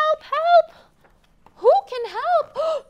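high, loud little duck crying help; duck calling for help. Acted. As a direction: squeak out a panicky call for help.

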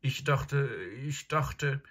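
put-on flustered boy caught out; stammering. A narrator's reading. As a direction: stumble over the words. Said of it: "stammelte Edmund".

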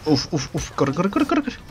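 panicked breathless run, pitch jumping up; being chased. Real, not acted. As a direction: gasp and urge yourself to run. Fleeing enemies in game.

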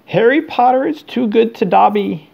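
high squeaky elf voice, tearful; squeaky house elf. A narrator's reading. Dobby squeaking his thanks to Harry in a high voice. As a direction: squeak it out like a grateful little elf. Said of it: "he squeaked".

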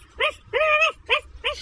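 shrill loud cackling laugh; high cackle. Acted. Dog laughs at intruder hearing only barks, high cackle. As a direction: cackle at your own joke.

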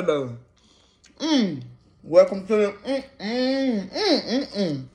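chewing while talking; muffled eating sounds; mouth full of burger. Real, not acted. Seen 2: Bites burger at -1, cheeks full and chewing through labelled words.

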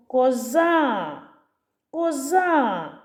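«коза» called with long stressed final а; calling out. Shown as a demonstration. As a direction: call the goat, stretching ko-ZAAA in «коза». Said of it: "Давайте покличемо". (кличе протяжно, наголос на «а» в слові «коза»)

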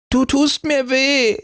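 put-on very high, wailing little girl; wailing child. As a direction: cry out in pain like a child. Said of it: "heulte Lieselotte".

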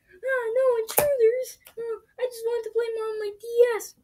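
high, crying whine; whiny high voice. Acted. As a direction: whimper like a spoiled kid. Whiny character woken up.

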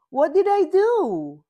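high loud bewildered question. A narrator's reading. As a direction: ask in shock. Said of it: "asked Unicorn".

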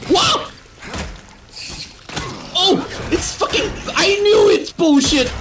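high startled yelp into indignant cursing; jolt, then protest in a high voice; real jump-scare alarm; startled outburst. Real, not acted. Another jump scare in game.

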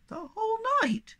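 very high, tiny squeaky voice; squeaking. A narrator's reading. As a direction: squeak the question out. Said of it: "squeaked Sniff".

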